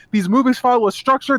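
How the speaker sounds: ordinary delivery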